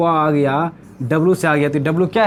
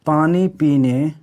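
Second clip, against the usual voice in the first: slow dictation; 3 semitones lower, slower than usual. Shown as a demonstration. Teacher slowly dictating the memory trick for notes. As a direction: dictate each word of the trick slowly, with long pauses. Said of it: "इसका हमने एक trick बनाया है".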